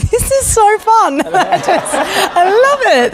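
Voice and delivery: high, drawn-out gushing excitement; giddy delight. Retold or imitated. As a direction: gush like a thrilled first-timer. Re-enacting delight at theatre games.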